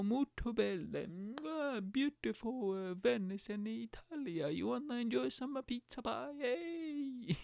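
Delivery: hammed-up Italian boatman welcome; booming sing song welcome. Venice gondolier greeting tourists.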